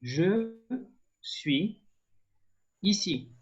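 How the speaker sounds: "Je suis ici" with a long pause after each word; word-by-word demonstration. Shown as a demonstration. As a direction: model it for learners, one separated word at a time. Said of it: "you will say".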